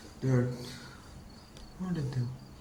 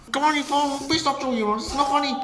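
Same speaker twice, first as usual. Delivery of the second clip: high, raised pleading protest; panicked scolding; 13 semitones higher, 4 dB louder, faster than usual. Acted. Engine telling a friend it's not funny, panicked. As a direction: plead shrilly for it to end.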